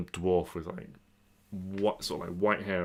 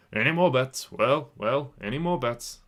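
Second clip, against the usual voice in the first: louder, higher bookie calling out; bookie shouting odds; 5 semitones higher, 6 dB louder, slower than usual. Acted. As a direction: call it out to the whole crowd. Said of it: "calls out really loud".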